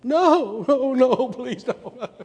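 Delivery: high, loud begging no no please don't; a frightened little girl pleading; panicky pleading child. Retold or imitated. Child pleading against spanking.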